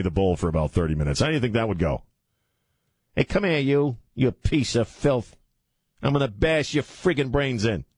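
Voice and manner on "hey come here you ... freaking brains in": mobster threat. Acted. Acted mob threat to Cuomo.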